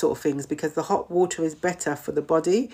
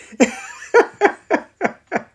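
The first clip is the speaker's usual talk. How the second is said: high burst of laughter; helpless giggling laugh; real laugh; 13 semitones higher, 5 dB louder than usual. Real, not acted. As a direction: laugh out loud at your own slip. Laughs at own tongue-tied slip.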